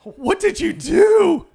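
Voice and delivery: high, slow accusing cry; mock outrage. Accusing Josh over the bad beer, high, drawn-out outrage. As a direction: wail it like a betrayed friend.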